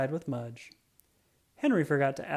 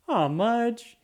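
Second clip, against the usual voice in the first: fond complaint; 8 semitones higher, 5 dB louder than usual. A narrator's reading. Henry wiped wet by dog.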